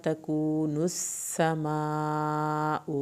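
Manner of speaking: slow, drawn-out tajweed chant; Quran recitation. Shown as a demonstration. Teacher recites to students, slow tajweed chant. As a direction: recite it slowly as a model for students. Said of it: "میم کی زبر کو نہیں لمبا کھینچنا".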